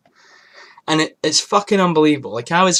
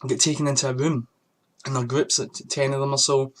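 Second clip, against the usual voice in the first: ordinary talk, nothing marked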